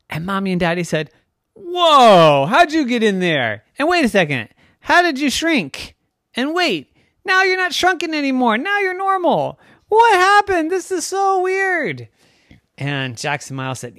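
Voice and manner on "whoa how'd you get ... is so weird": high, loud bewilderment; astonished parents. Acted. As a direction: pile up stunned questions, climbing higher. Parents astonished as kids appear.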